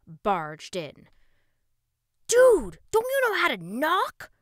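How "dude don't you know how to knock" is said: put-on loud high snapping boy; angry snap. A narrator's reading. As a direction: snap it at mom furiously. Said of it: "Russ snapped at his mother".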